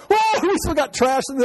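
hammed-up high pilot voice; mock pilot. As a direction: squeak it out like a panicking pilot. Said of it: "It's like".

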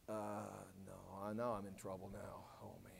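very low, soft muttered worry; sinking dismay. Acted. Pilate caught between Jesus and Caesar, quiet dismay. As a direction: mutter it to yourself, cornered. Pilate voiced realizing he is in trouble.

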